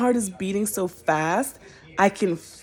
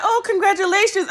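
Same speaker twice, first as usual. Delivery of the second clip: mock cheerful attendant; 12 semitones higher, 4 dB louder than usual. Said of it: "she's like".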